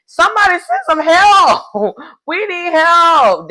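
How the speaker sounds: high, loud hammed-up cry for help, laughing through it; mock panic. Teammate lost at sea calls for help, mock panic. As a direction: play a rower stranded in circles, begging for rescue. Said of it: "somebody else in the boat was like".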